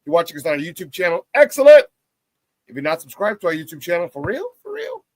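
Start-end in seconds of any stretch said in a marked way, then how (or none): 1.3-1.9 loud, high excellent; excited praise. Acted. Host praising YouTube viewers, loud and excited. Cheering viewers who watch. 4.1-5.0 high, put-on for real; playful high put-on plea; mock pleading. Host coaxing viewers to subscribe, high put-on voice.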